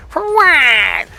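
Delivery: high, loud, grating scorn; mocking wife's irritated reply; irritating wife voice. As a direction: sneer it as annoyingly as possible. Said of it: "The most irritating voice you could s-, imagine".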